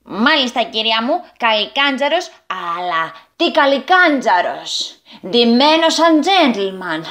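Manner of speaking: slow, smiling proud goblin voice. A narrator's reading. Tipoukito introduces himself to the old lady, smiling proudly. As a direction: boast with a smile and a bow. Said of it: "είπε ο Τιπουκίτο χαμογελώντας".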